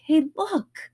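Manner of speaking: high, loud, thrilled recognition; light up like a kid spotting their hero; a fan shouting happily that a character is like them; delighted fan. Retold or imitated. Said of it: "their faces light up when they're like".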